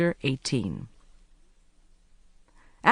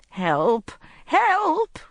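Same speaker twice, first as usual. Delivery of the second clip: high, loud panicked cries; rising frantic shout; cry for help; 13 semitones higher, 6 dB louder than usual. A narrator's reading. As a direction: scream for help as they drag her. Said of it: "cried Missus Polyfax".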